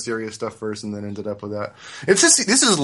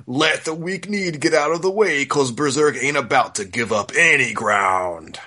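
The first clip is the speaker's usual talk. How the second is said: dramatic trailer-announcer read, breaking into laughs; mock epic voice-over, barely holding it together; hammed-up blurb voice; 3 semitones higher than usual. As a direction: sell it like an over-the-top back-cover blurb. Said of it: "Do, do the voice".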